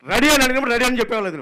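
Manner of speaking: imitated angry director; mock director scolding. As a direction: rattle off the rebuke impatiently. Retold director scolding assistant.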